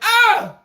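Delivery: sudden very high mock scream; high, hammed-up shriek of pain; mock flop scream. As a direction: imitate a player faking a foul scream. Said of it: "He would just scream like".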